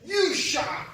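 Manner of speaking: mimicked high, loud order; retold rude shout. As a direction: act out a stranger shouting someone down. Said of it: "turned around again and said".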